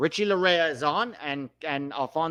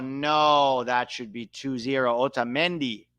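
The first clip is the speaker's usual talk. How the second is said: ordinary talk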